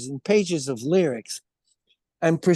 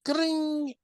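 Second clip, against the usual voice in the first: strummed chord sound; 13 semitones higher, 4 dB quieter than usual. Retold or imitated. Imitating first guitar chord.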